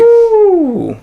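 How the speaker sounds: high, sustained "oooh"; excited "ooh". Real, not acted. Cheering news of getting a Pro.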